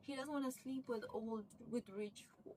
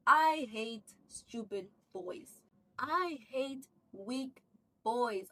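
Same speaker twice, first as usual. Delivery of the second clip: furious berating; 4 semitones higher, 6 dB louder than usual. Retold or imitated. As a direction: spit the contempt at him, word by word. Sarah berating weak husband.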